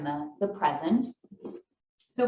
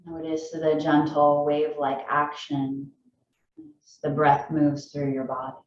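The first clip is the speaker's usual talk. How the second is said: calm, low-key meditation voice, long pauses; unhurried phrases, each left to settle; guided meditation; 4 semitones lower than usual. Acted. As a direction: guide them gently into the breath.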